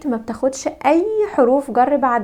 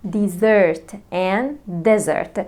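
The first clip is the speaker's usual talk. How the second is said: "Desert and desert" slowly, stress moving from first syllable to second; contrasting stress; 3 semitones lower, much slower than usual. Shown as a demonstration. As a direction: show the stress shift slowly. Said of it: "the stress في كلمة desert". (النبر يتحرك من المقطع الأول للتاني ببطء)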